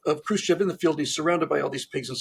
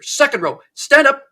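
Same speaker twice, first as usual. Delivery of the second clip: loud command leaping higher; angry Stalin; 9 semitones higher, 8 dB louder than usual. Acted. Angry Stalin orders the next row up, shouted command. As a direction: shout an order like a furious dictator. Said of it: "Comrade Stalin is clearly angry".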